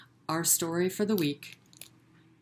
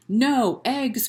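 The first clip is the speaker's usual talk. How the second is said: loud, higher farmer's outcry; anguished cry; 7 semitones higher, 7 dB louder than usual. Acted. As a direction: cry it out in despair. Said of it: "cried Farmer Brown".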